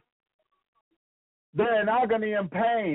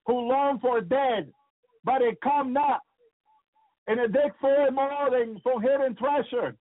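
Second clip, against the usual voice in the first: scripture reading; 4 semitones higher than usual. Acted. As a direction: read the verse out with weight.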